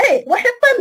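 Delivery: a high, loud startled Eh; mock amazement from the senior; surprised exclamation. (terkejut, suara tinggi dan kuat)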